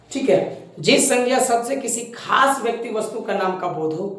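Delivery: ordinary talk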